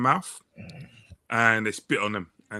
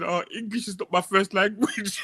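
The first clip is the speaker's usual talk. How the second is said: mock excuse; 8 semitones higher than usual. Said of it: "She just said".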